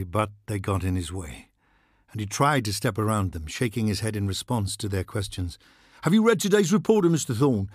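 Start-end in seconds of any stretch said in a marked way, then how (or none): 6.0-7.7 high, fast, pushy reporter voice; brash press hound pouncing on a diplomat. Acted. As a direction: fire the question at him, eager and fast. Reporters pestering Thorne.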